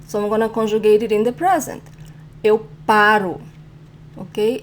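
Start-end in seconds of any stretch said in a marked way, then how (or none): 2.4-3.4 PAro, first syllable strong, ending weak; stress second-to-last syllable. Shown as a demonstration. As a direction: lean on PA in "paro" and let -ro drop. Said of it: "The stronger syllable is the second to last".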